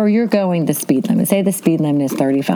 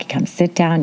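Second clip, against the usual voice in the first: ordinary talk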